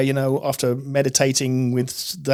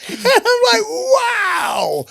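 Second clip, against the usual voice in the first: stunned wow; much slower than usual. Retold or imitated. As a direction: cry out in sheer amazement. Stunned by renunciate story.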